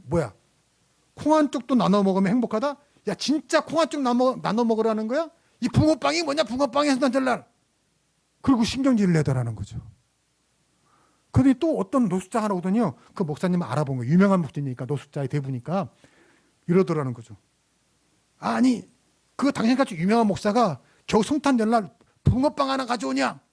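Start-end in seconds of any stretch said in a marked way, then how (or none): 0.1-7.5 raised, higher-pitched scolding, retold half-acted; mock angry rant. Homeless woman snaps at the pastor over fish bread, mock angry. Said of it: "화를 내더라는 거예요". 18.4-23.4 higher-pitched sneering reproach, retold; mock angry scolding. Homeless man scolds the pastor over one fish bread, mock angry. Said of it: "이렇게 막 화를 내더라는 거죠".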